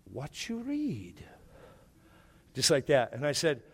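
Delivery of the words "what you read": put-on hushed, slow whisper of an old Korean man; imitating old man. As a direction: lean in and whisper it like a frail elderly man. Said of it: "he goes".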